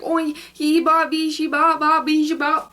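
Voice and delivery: hammed-up babble of a customer; imitating gibberish. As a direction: cashier imitating the customer's gibberish, loud and mocking. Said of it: "he was just speaking gibberish".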